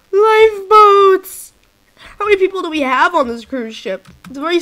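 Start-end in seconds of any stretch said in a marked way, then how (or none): 0.1-1.5 slow, loud, high call; drawn-out shout. Real, not acted. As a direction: stretch it out and shout. Calling out lifeboats.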